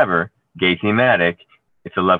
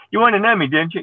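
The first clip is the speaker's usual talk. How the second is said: much higher, louder gushing fan voice; mock impressed fan; 13 semitones higher, 5 dB louder than usual. Joking about fans, a high gushing admirer. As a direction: imitate someone fawning over the Emmy. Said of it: "I joke when someone says".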